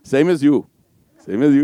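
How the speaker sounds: imitated Welsh lad, played for laughs; Welsh accent. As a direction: repeat it in a playful Welsh lilt. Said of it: "That's my best Welsh accent".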